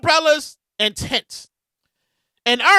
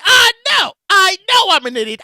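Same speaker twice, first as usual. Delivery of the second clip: loud, higher-pitched mock retort; mock exasperation; 5 semitones higher, 5 dB louder than usual. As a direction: fire back at an imagined heckler. Mock retort to imagined listener.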